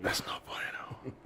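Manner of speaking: barely audible, voiceless whisper. Pretend. Host repeating the verdict on a losing record, whispered. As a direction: shrink it to the faintest whisper. Whispered echo of own phrase.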